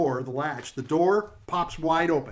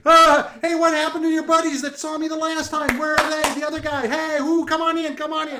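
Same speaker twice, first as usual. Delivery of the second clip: high, loud rapid-patter host voice; pitched-up heckling greeter; brash excitable jokester; 11 semitones higher, 7 dB louder than usual. Acted. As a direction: usher guests in with pushy showman chatter. Jokester figure hails the guests.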